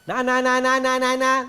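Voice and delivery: teasing playground chant; childish taunt. Shown as a demonstration. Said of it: "like a child in a playground".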